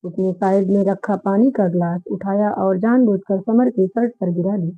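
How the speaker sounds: ordinary delivery, nothing marked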